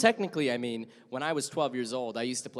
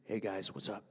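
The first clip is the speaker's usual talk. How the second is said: slightly lower, softer greeting; a bit lower voice; 5 semitones lower, 8 dB quieter than usual. Shown as a demonstration. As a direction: drop it into a lower voice. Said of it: "then I'd be like".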